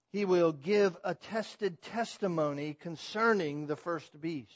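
usual speaking voice

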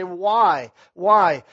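loud, insistent "Why? Why?"; a hint of a doubter demanding why; mock objector. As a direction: voice a listener pushing back. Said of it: "You say".

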